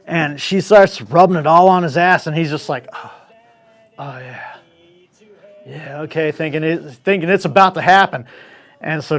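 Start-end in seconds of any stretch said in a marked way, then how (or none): 2.9-6.3 whispered, softer moan of pleasure; blissed whisper. Retold or imitated. Man waiting for sex, whispered blissful moan. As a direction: sigh it out, lost in pleasure.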